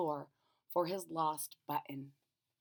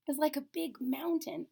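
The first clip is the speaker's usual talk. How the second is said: ordinary delivery, nothing marked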